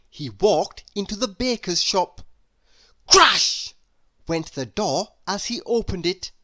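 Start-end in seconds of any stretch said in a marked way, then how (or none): 3.1-3.7 shouted higher crash. Acted. Reader voicing the crashing shop door, a high loud shout.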